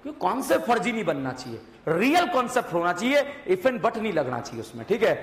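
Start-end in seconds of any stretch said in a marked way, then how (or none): none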